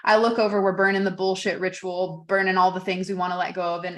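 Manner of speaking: normal talking voice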